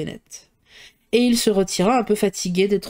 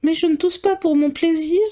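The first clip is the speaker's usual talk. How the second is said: put-on higher, peeved girl's answer; sulky retort; 8 semitones higher than usual. A narrator's reading. Kitty answering her father, mildly peeved. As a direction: snap back like a sulky girl. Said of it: "répliqua Kitty avec humeur".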